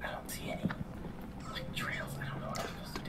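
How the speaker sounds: very soft, voiceless whisper. Real, not acted. Players searching the woods for trails, whispering. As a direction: murmur it below the mic's threshold. Whispering in scream-detecting game.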